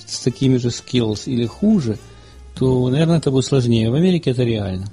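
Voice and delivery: ordinary talk, nothing marked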